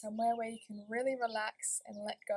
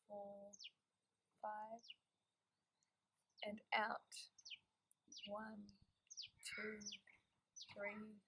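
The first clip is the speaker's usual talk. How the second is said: guided breathing meditation; 7 dB quieter than usual. Acted. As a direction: guide them gently through each breath, unhurried.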